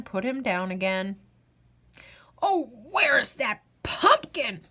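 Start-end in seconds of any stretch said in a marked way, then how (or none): 2.4-4.7 put-on croaky, lamenting old man; old man croaking in despair. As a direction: moan it like a fretful ancient. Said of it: "croaked the old man".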